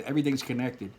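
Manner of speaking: usual speaking voice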